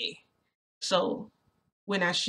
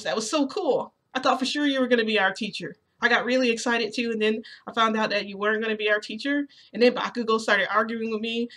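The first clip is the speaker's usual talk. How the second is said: higher-pitched eager fan; excited rambling; 4 semitones higher, 4 dB louder than usual. Acted. Midoriya tells hoped-for teacher what happened, excited rambling. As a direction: spill it all out excitedly. Midoriya keeps gushing to her.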